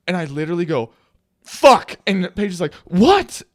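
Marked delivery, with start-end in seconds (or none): 1.4-2.0 high, explosive outburst; loud curse. Retold or imitated. Fan cursing out loud on a plane, loud outburst. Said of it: "I said it out loud". 2.8-3.4 high, loud, startled what; startled question. Retold or imitated. Companion startled by his cursing on the plane, alarmed question.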